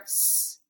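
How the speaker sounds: "s" as a lone voiceless hiss; s sound. Shown as a demonstration. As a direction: hold "s" softly by itself. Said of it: "to either say sh or".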